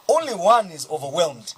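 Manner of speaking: ordinary talk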